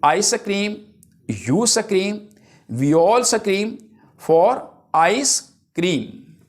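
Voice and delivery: very slow, phrases lifting at the end; drawn-out classroom chant; slow rhyme recitation. Shown as a demonstration. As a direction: recite the rhyme piece by piece, long pauses. Reciting rhyme as alliteration example.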